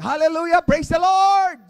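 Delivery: full-voice high Hallelujah shout; shout of praise. Shown as a demonstration. Pastor leading the church in a loud shout. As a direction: shout it to the rafters. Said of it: "pinapasigaw na tayo".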